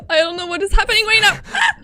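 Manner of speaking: panicked. Real, not acted. Panicked after scare.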